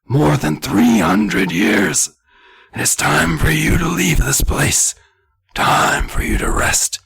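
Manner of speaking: loud, high, drawn-out straining whisper; harsh stage whisper. Acted. Old man confronting the kids, harsh loud whisper. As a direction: force a slow whisper until the throat hurts. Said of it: "that whisper that destroys my vocal cords".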